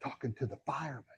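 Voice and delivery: put-on quick soft child's whisper; little boy whispering. Boy whispers to the realtor on the phone. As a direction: whisper it fast like a kid hiding. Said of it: "he's whispering".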